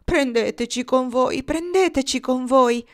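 urgent entreaty called out; imploring. A narrator's reading. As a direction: beg to go along. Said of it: "e implorarono".

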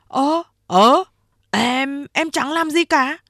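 high, hesitant underling; stammering denial. A narrator's reading. Henchman stammers a denial to boss.

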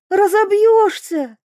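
high, loud warning cry; desperate yell. A narrator's reading. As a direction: shout in panic. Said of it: "завопила принцесса".

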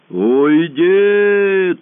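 loud, stretched monster command; dull drawn-out growl. A narrator's reading. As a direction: drag it out dumbly. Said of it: "тупо протянуло в ответ чудовище".

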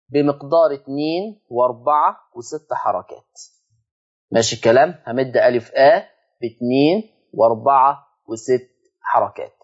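ordinary talk, nothing marked

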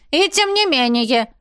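huffy objection; grumbling. A narrator's reading. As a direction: keep griping in the gnome's voice. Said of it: "продолжил бухтеть Глорин".

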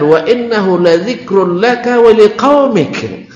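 slow, high, chanted verse; Quran recitation. Acted. Preacher recites a verse to his listeners, slow chant. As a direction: intone the verse slowly.

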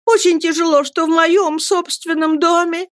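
high-pitched aggrieved wife; protesting mother. A narrator's reading. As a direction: complain in a high, wounded voice. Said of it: "продолжала матушка". (высоким голосом, жалобно протестуя)

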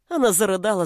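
ordinary delivery

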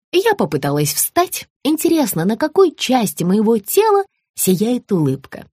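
plain reading voice

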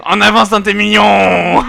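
laughing, high battle shout; war cry. Acted. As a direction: yell it like charging into battle. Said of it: "un cri de guerre?".

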